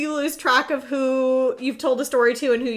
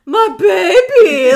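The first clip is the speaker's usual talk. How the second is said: put-on higher, louder, slow gushing baby talk; dog voice; 6 semitones higher, 11 dB louder, much slower than usual. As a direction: squeal over a photo of the dog. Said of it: "talk in dog voice".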